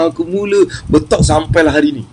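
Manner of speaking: normal talking voice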